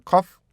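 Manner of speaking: "coffee" as "cof", long held stop, then "fee"; split at F. Shown as a demonstration. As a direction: break "coffee" in two with a long pause on the f. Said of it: "이렇게 발음돼요".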